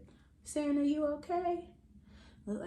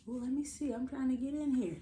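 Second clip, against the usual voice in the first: ordinary delivery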